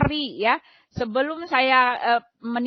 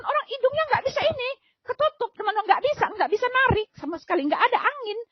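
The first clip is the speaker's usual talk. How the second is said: panicked; 10 semitones higher, faster than usual. Retold or imitated. Reliving being unable to breathe.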